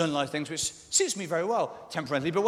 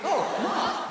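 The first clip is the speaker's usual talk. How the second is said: high, lifted greeting cry; delighted recognition. Retold or imitated. Comic thinking friends are hailing him, high delighted call. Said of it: "You think".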